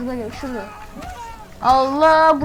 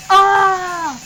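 loud, drawn-out held "aah"; raised, sustained mock scream; imitated shock scream; 4 semitones higher, 6 dB louder than usual. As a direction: mimic a man yelling as he gets zapped. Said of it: "adam neden böyle bağırıyor".

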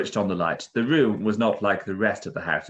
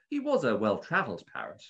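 ordinary delivery, nothing marked

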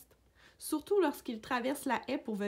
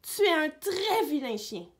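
higher, louder telling-off; angry scolding; 5 semitones higher, 6 dB louder than usual. A narrator's reading. Emma tells off her naughty dog, loud scolding. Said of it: "a hurlé Emma".

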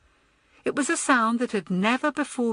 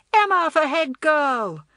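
high, slow, louder slogan; rallying cry; 9 semitones higher, 5 dB louder than usual. A narrator's reading. Naughtiest girl proposing Emma to the school, crying out. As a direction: chant it out to rally a crowd. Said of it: "cried the naughtiest girl".

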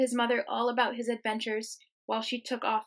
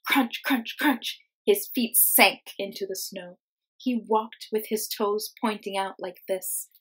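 put-on lively, expressive read-aloud for kids; reading to children; 5 dB louder than usual. Teacher reading a picture book to her class, lively storytime voice.

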